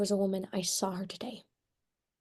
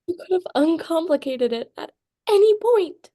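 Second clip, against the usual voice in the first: rising furious accusation, louder; angry outburst; 9 semitones higher, 4 dB louder than usual. Acted. Daughter accusing her mother of a lifelong lie. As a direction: throw the lie back at her.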